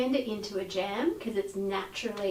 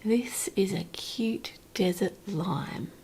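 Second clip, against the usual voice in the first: whispering. Real, not acted. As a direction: hush it like a secret. Presenting fruit to tasters.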